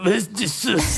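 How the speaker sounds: put-on French accent, slow and laughing; guttural French accent. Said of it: "make it real guttural if you're doing the French".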